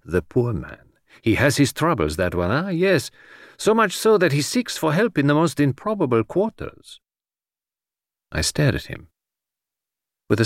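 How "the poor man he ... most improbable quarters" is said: lightly voiced foreign detective, musing then rising; hint at a secret with mild sympathy; Poirot, knowingly sympathetic. Detective hints to his friend about the minister's troubles, knowingly.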